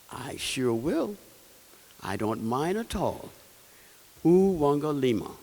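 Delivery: kind king voice. Acted. Kind king answers gently.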